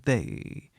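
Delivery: THEY dropped low with scornful weight; scornful emphasis. A narrator's reading. Man blaming her family, THEY said low with scorn. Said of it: "a word of scornful emphasis".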